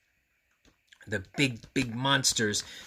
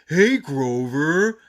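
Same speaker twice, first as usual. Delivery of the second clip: slow, louder calling voice; Monty calling out; 4 semitones higher, 9 dB louder, much slower than usual. Acted. Monty Monster greeting Grover in the store, calling. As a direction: call across the store to a friend. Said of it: "Monty Monster called to him".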